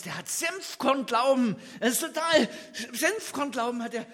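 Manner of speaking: normal talking voice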